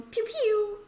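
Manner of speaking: high, loud toy-laser sound; pew pew blasts. Acted. [name] miming Iron Man arm blasters, high laser noises. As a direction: fire off imaginary arm blasters.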